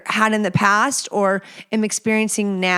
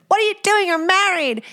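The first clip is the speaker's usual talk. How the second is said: friend scolding; 10 semitones higher, faster than usual. Retold or imitated. Best friend catching her flirting, higher shocked scolding. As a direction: squeal it like a scandalised best friend. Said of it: "she'd be like".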